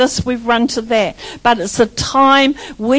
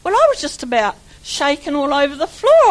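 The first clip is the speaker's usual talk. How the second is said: ordinary talk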